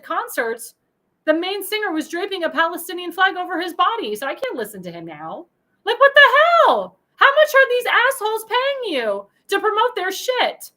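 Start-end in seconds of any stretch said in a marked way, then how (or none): 5.9-10.7 much higher, louder, slow disgusted shout; real furious rant outburst; outraged yell. Real, not acted. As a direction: blow up at the singer in fury. Ranting vlogger's outraged outburst.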